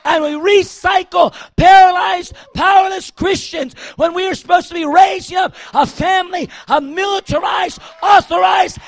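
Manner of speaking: loud, driving sermon delivery; preaching at full force. Real, not acted. Preacher shouting at his congregation, full force. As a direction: preach it hard to the whole room.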